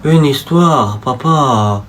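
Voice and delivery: put-on low, soft, drowsy little kid; sleepy child. Sleepy son asking his dad for a bedtime story.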